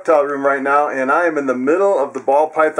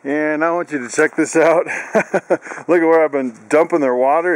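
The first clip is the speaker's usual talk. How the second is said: usual speaking voice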